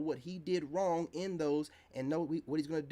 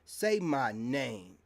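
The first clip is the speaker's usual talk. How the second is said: slow taunt; 3 semitones lower, 4 dB louder, much slower than usual. Real, not acted. To online women critics, a slow drawn-out taunt. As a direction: say my name, drawn out slow and firm.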